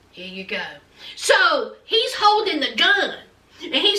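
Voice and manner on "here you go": very low and soft; grim handover. Retold or imitated. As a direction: hand it over in a hushed, deep voice. Retold father handing over gun.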